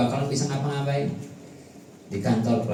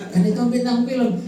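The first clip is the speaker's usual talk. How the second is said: questioner; 11 semitones higher than usual. Retold or imitated. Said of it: "Ibu-ibu nonton film India".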